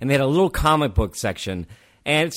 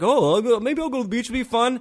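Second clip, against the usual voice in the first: naive friend; 9 semitones higher than usual. Retold or imitated. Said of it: "And he's like".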